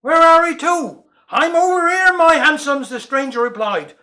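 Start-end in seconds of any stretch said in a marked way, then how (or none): 0.0-1.0 called out louder, pitched up, in Cornish dialect; shouted question. A narrator's reading. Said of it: "he shouted". 1.3-2.9 a louder, higher call back from afar; a drunk hollering back cheerfully. A narrator's reading. Drunk stranger calling back.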